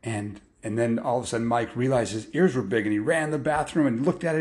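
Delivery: plain reading voice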